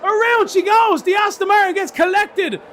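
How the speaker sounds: high, loud crash call; excited shout. Real, not acted. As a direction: yell it as the crash happens. Crash called live.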